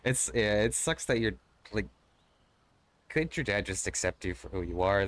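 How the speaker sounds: ordinary delivery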